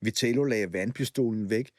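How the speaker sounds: usual speaking voice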